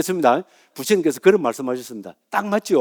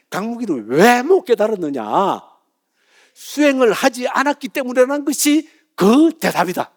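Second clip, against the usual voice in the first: high, slow, ringing recitation; stretched-out mock-solemn pronouncement; declaimed verdict; 7 semitones higher than usual. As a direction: proclaim the question and its answer like scripture.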